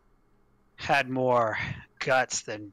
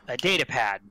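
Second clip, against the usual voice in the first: normal talking voice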